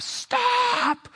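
very high, held-out cry without full volume; mock scream. Father to daughter in the truck's path, restrained high scream. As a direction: imitate a scream, holding back the volume. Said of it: "just screams".

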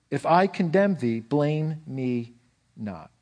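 put-on slow, measured reading of an old inscription, rising on each "You call me"; solemn recitation. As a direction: intone it like scripture carved in stone, slowly. Reads old cathedral inscription.

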